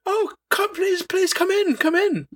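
a prince abruptly welcoming the hero he just turned away; sudden eager welcome. Retold or imitated. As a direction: flip from cold to fawning host. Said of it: "that's how fast it changes".

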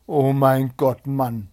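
slow, loud opening cry; dismayed cry. A narrator's reading. Wife hearing of her husband's bet, dismayed cry. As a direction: cry out in dismay, stretching the first words. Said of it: "schrie dem Zwienägel seine Frau".

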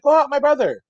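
high mock call, not shouted; imitating wife's plea. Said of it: "his wife's like".

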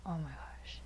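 breathy unvoiced whisper. Real, not acted. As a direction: whisper it in disbelief. Whispered exclamation.

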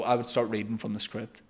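ordinary talk, nothing marked

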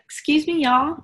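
high, bright mock-polite "Excuse me, y'all"; mock Southern politeness. Host teasing Julia about airport talk, high mock politeness. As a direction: ham up the newly Southern traveler. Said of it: "You're like".

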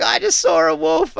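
very high, loud blurt; retold excited blurt. Telling girlfriend after fleeing a wolf, re-enacted excited blurt. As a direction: burst out with the news, re-enacted. Acting out breathless news after running home.

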